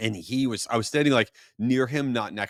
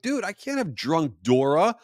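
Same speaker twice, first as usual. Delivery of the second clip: higher, incredulous telling-off; exasperated scolding; 6 semitones higher than usual. Retold or imitated. Dad scolding the hungover Dora performer, incredulous and higher. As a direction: scold him, half laughing in disbelief. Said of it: "I'm like".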